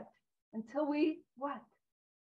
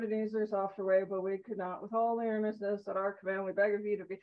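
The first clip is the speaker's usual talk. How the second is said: low, quieter, bored monotone read; droning Peanuts teacher; 7 semitones lower, 4 dB quieter than usual. Retold or imitated. Reading the book aloud to the group, flat and droning. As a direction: drone it like a teacher nobody listens to. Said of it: "like the Peanuts ... the teacher from the show".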